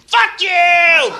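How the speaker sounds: high, loud hammed-up rant of fuck you; mock furious yelling. Imitating a righteous attacker screaming abuse, hammed-up and loud. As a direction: scream it like an unhinged heckler. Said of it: "People fly in to meet you".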